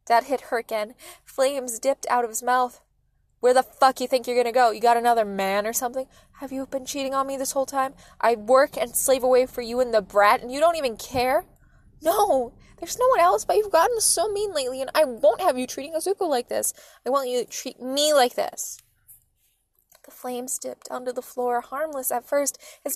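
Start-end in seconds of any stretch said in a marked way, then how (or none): 3.4-11.5 loud, heated questioning; raised-voice domestic rant; angry accusing father. A narrator's reading. 12.0-18.8 high, loud, pleading anger; raised, higher-pitched emotional rebuttal; upset protest. A narrator's reading. Mother argues back, upset.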